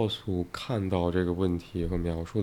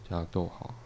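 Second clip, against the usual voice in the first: dictating punctuation; 6 dB quieter than usual. Shown as a demonstration.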